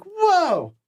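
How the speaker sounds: high alarmed whoa, far from his own voice; startled yelp. Retold or imitated. Shot actor noticing the blood, high startled whoa. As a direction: jump as if you just saw blood. Ned realizes he's shot.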